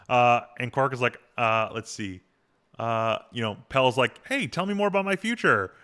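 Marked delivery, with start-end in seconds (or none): 4.3-5.7 high, mock-eager retold sarcasm; lightly acted sarcastic jab. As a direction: needle them with pretend interest. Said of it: "like, being sarcastic".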